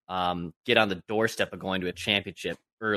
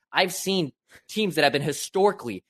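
exasperated rant; 9 semitones higher than usual. Real, not acted. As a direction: pitch climbing high into an exasperated crap. Fan venting about losing teams.

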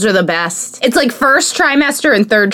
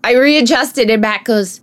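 ordinary talk, nothing marked

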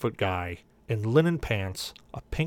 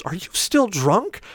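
higher, slow suspicious question; suspicious sister-in-law; 8 semitones higher, slower than usual. Retold or imitated. Sister-in-law asks drunk man slowly, suspicious. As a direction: ask it slowly and pointedly.

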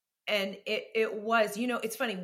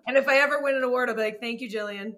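mock acceptance speech; 3 semitones higher, 7 dB louder than usual. Joking award speech.

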